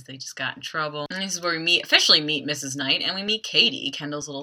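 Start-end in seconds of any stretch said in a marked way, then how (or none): none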